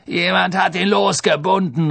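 irate, high-pitched accusation; gruff grousing executioner. A narrator's reading. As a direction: grouse it out loudly. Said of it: "raunzte der Henker".

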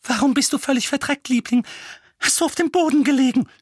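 high, fast fretting mother; fussing mother. A narrator's reading. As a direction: fret over him quickly. Petunia voiced.

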